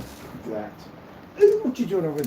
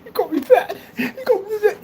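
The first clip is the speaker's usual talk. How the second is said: very high, laughing mock whine; mock crybaby; 4 dB louder than usual. Mocking his own fake tears on camera, high and laughing. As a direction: squeal it like a hurt crybaby, laughing. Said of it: "then I said on camera".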